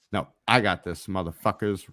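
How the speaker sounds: ordinary talk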